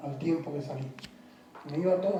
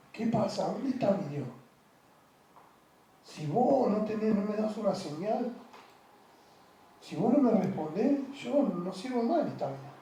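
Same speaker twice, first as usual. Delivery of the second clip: retold tearful plea; 4 dB quieter than usual. A man retelling his desperate prayer to God, softly tearful. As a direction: ask God where he is, broken and quiet. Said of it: "donde llorando le dije".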